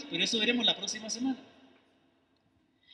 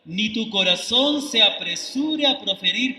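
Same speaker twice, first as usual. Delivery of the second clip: slow, louder, weighty reading of "acércate más"; solemn scripture reading; 2 semitones higher, 5 dB louder, slower than usual. Acted. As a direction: read "acércate más para oír" slowly, loud and solemn, as holy text. Reads scripture aloud solemnly. (lectura bíblica solemne, lenta y fuerte)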